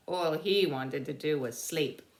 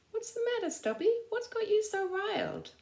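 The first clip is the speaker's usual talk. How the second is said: much higher, puzzled soldier voice; curious buddy. Acted. Conroy's buddy asks the agitated dog what's wrong, puzzled.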